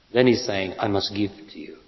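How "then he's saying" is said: normal talking voice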